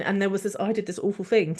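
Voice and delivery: usual speaking voice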